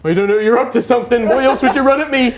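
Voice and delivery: high, loud, fast nervous accusation; nervous suspicion. Acted. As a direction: rattle it off, scared and suspicious. Rival frightened, suspicious.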